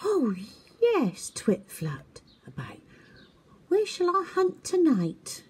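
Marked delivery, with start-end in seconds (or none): 0.0-1.3 high, puzzled and innocent; confused little owl voice. A narrator's reading. Twit eager. 3.7-5.4 high, puzzled and innocent; confused little owl voice. A narrator's reading. Twit eager.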